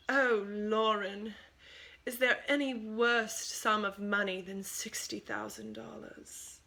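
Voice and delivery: slow, hushed imitation of a British accent, half whispered; gentle mock British accent, fading to a whisper; butchered British accent. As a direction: lightly mimic a British friend's accent, drawing out the words. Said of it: "butchering her accent here".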